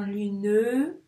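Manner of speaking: "lune" as lu-neu, last vowel held; emphatic two-syllable "lune"; final e voiced. Shown as a demonstration.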